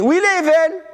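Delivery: high ringing divine call; God's question. Acted. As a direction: ask as God demanding an answer. God asks Cain about Abel.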